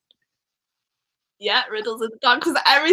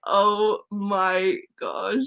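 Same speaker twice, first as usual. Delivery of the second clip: mock teary gush; much slower than usual. Retelling her own reaction to a friend tearing up, mock weepy. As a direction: gush as if moved to tears, half joking. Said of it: "and I was like".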